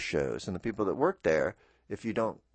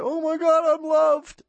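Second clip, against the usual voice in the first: much higher and louder, teary joyful gasp; overwhelmed gratitude; 7 dB louder than usual. Retold or imitated. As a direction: burst out as if surprised by love. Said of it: "And then I, I weep".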